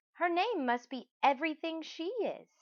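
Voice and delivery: a proud new mother, beaming; mother's voice. Acted. Mother dotes on her newborn, high proud voice.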